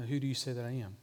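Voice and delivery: soft, quick, intimate question; gentle nudge. Acted. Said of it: "I hear Jesus nudge me and say".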